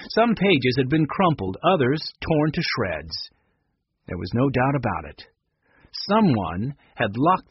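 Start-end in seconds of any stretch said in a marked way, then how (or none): none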